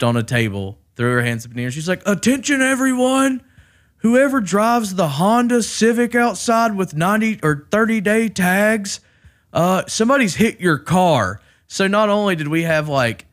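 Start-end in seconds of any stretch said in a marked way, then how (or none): 2.0-11.4 high, loud call to the whole room; unsubtle public announcement. Retold or imitated. Lady on a table addressing everyone, loud announcement. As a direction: announce it to everyone, zero subtlety. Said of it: "no subtlety whatsoever".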